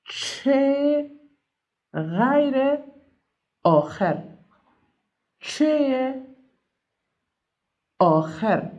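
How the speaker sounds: each word alone with long pauses; slow dictation. Shown as a demonstration. Teacher leading pupils in tracing letters, slow spaced-out naming. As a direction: draw out each name slowly while tracing it.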